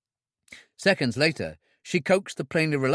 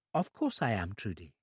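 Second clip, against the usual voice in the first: soft reassurance; 4 semitones lower, 8 dB quieter than usual. A narrator's reading. Maggie reassures softly.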